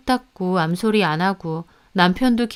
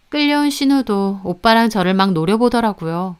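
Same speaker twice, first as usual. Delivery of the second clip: ordinary delivery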